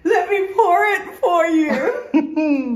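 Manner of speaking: high laughing call, slow. Real, not acted. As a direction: laugh through the offer. Offering a drink, laughing.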